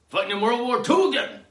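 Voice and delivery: high, loud comic climax; heated outburst. Acted. Host riffing on ketamine trips, loud outburst. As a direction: burst out at the top of the riff. Climax of drug trip riff.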